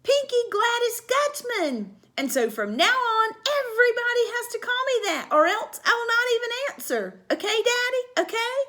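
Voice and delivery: high, loud, gleeful demand; little girl demanding her dad obey; happy and bossy. Acted. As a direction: insist cheerfully. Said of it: "clapped my hands real happy".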